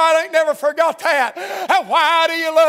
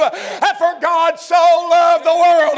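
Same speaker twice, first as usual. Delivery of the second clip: slow, swelling preacher's cry, a bit louder; preaching; 2 semitones higher, 4 dB louder, slower than usual. Acted. Preacher lifting the verse to his congregation, slow and swelling. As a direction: lean into the verse, drawing it out.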